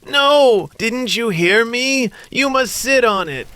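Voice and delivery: high, sharp, exasperated; impatient snake girl. Acted. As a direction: scold the silly boy.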